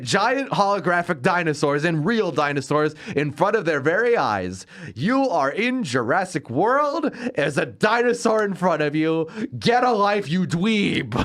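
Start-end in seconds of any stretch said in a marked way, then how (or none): 4.9-11.1 mock rant. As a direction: tell off a whiny teen on screen. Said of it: "That's my response".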